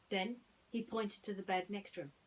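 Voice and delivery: ordinary delivery, nothing marked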